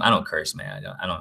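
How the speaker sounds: ordinary talk, nothing marked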